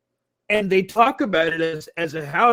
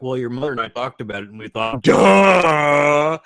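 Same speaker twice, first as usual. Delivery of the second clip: parents' mocking duh; 2 semitones lower, 7 dB louder than usual. Parents answering their son with a loud mocking duh. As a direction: rush the setup, then blurt duh loudly. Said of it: "they're like".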